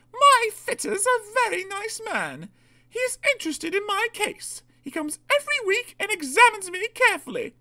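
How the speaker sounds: very high, prim diesel voice; self-important Daisy. Acted. Daisy quoting her fitter to the arguing staff, self-important. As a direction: lecture them in a high put-on voice.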